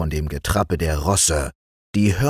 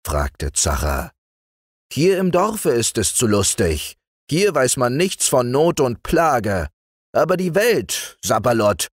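higher, mocking disbelief then grave; lightly voiced scoffing magician; 5 semitones higher than usual. A narrator's reading. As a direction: scoff at her worry, then turn serious. Zacher scoffs at mother.

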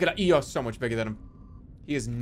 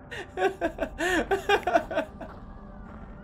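long high giggle; giggling; 3 dB quieter than usual. Real, not acted. Streamer laughing at own silly joke, giggling. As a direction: break into a high, stuttering laugh.

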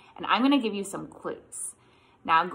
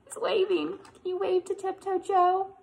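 ordinary delivery

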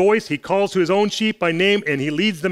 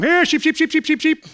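imitating a student calling sheep, higher; 10 semitones higher than usual. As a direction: call the flock from far off, pitched up. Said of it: "And they'd call to them".

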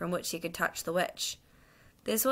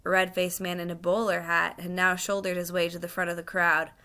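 ordinary delivery

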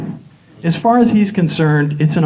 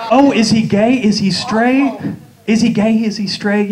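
higher, louder breathless gossip; mock dramatic dilemma; 7 semitones higher, 3 dB louder than usual. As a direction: ham up the soap-opera suspense. Mocking TV show dilemma.

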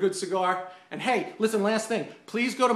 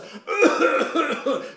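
loud, higher, hammed-up fake cough; mock fake cough; 8 semitones higher, 4 dB louder than usual. Couple on sidewalk mocking cigar smoke with a hammed-up cough. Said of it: "they start with the big fake coughs".

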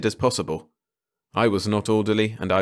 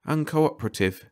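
higher, absently echoed UNCOOPERATIVE; absent-minded; 6 semitones higher than usual. A narrator's reading. Carter echoing Ranson's word, absent-minded. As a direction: repeat UNCOOPERATIVE as if only half listening, pitched up. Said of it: "in an absent way".

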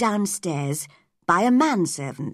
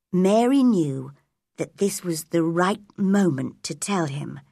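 plain reading voice

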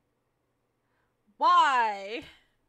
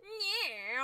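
new character voice; 7 semitones higher, 4 dB quieter than usual. Acted. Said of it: "I'll make him sound like this".

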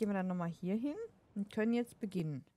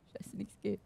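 whisper; 6 dB quieter than usual. Real, not acted. Frustrated aside.

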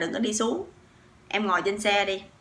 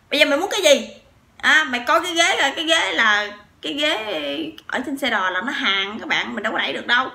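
higher and louder, fading at the end; heated retort; 6 semitones higher, 5 dB louder than usual. Retold or imitated. Husband arguing about the bus seat, acted shouting. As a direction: bark the challenge, then cool off explaining. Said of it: "nổi máu côn đồ lên".

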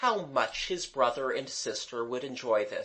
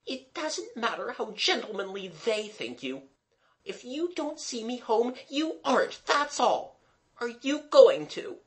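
put-on high-pitched, insistent girl's voice; demanding girl; 10 semitones higher than usual. A narrator's reading. Mabel pressing Gerald to see her home, demanding. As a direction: demand it in a much higher voice. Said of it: "Mabel demanded".

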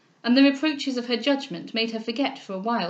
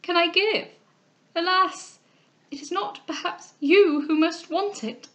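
high-pitched plaintive lament; acted tearful distress, raised pitch; weeping; 8 semitones higher, slower than usual. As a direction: plead in sorrow. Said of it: "cried the weeping Cecilia".

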